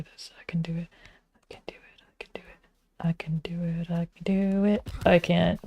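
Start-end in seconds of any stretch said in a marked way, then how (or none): 0.0-2.6 whispering to herself, urging herself on; whispered pep talk. Real, not acted. Self pep talk while playing. 3.0-3.9 I can do it whispered, no voice; whispered pep talk. Real, not acted. Self pep talk, whisper take.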